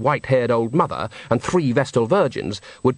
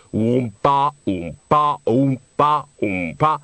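tuba oompa; much slower than usual. A narrator's reading. Narrator mimicking the tuba player's oompa, bouncy. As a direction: bounce it like a steady tuba line.

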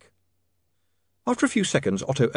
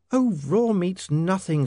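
high, slow lady's voice; reassuring young woman; 9 semitones higher, slower than usual. Acted. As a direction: dismiss his worry in a high voice. Sacharissa dismisses his shame.

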